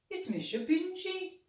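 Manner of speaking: high little dog voice. A narrator's reading. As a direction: speak up high like a small lost puppy. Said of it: "ar son madra beag".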